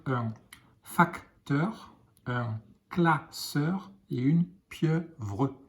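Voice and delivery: slow, careful dictation pace; teacher dictating words for the class to write. Shown as a demonstration. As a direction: dictate it slowly for children writing. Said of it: "dictating words to write".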